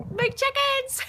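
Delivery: high squeal of delight; excited. Real, not acted. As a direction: gush over the chickens. Showing off chickens, high excited voice.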